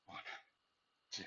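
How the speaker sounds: strained, breathy push mid-rep; lifting weights. Real, not acted. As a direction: force it out through the effort. Pushing through a hard rep.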